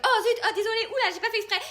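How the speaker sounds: high, polite flustered apology; prim apology. Retold or imitated. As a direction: play the overly polite prim girl. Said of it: "j'étais là en mode".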